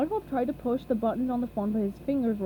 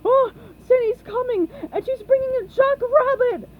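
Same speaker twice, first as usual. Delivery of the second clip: very high, loud panic; panicked cry; 13 semitones higher, 10 dB louder than usual. A narrator's reading. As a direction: cry out in alarm. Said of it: "cried Patrick".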